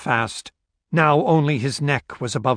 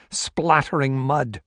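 usual speaking voice

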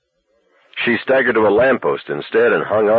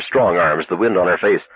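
usual speaking voice

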